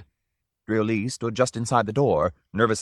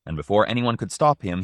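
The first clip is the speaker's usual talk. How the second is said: usual speaking voice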